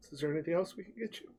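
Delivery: soft, quick, overly polite waiter; a nervous server rushing the offer. Acted. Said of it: "sets it down and goes".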